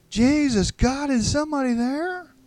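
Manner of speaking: very high, loud cry for anybody; desperate shout. A narrator's reading. Trapped stranger calling for somebody, desperate shouting. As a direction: yell it desperately at the top of your voice. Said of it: "more desperate this time".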